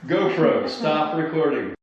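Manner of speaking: loud call to the camera; shouted order to the GoPro. Real, not acted. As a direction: call out across the room. Calling out to camera.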